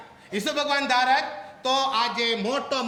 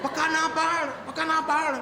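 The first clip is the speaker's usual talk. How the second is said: urgent prompt; 6 semitones higher than usual. Retold or imitated. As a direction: push the boy to refuse. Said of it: "sister કે".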